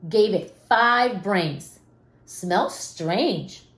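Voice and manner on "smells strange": dad voice. Acted. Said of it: "said the dad".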